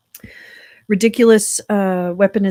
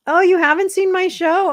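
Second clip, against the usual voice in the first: excited; 12 semitones higher, 6 dB louder than usual. Real, not acted. Greeting a newcomer.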